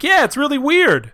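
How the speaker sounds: high, mock-innocent husband voice; cornered husband. Retelling the husband fibbing to his wife, mock innocent.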